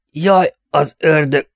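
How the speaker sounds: a wounded man cries out from the cliff; cry from above. A narrator's reading. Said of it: "egy hang kiáltotta ezt".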